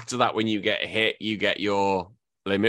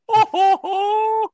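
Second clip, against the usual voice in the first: high, loud hammed-up wail; exaggerated cry, pitched way up; mock sobbing; 7 dB louder, much slower than usual. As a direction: fake bawling like a little kid. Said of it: "but it was like".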